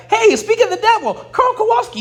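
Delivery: high, loud, delighted call-out; mock thrilled speak of the devil; excited greeting. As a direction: greet the colonel with gleeful surprise. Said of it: "And we're like".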